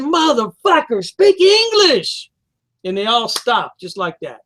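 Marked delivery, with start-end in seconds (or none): 0.0-2.3 high, slow, drawn-out exasperation; angry retold demand. Man retelling confronting shoppers at Walmart, angry demand. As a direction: stretch out each word in a mock-furious demand.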